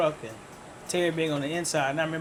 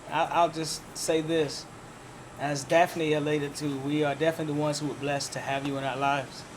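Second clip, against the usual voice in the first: usual speaking voice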